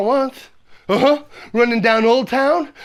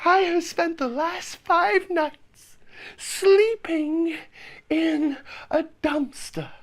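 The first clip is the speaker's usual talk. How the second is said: slow sad admission; 4 semitones higher, 3 dB quieter, slower than usual. Real, not acted. Sad confession.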